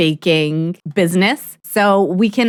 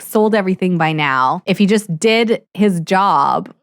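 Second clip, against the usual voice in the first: ordinary delivery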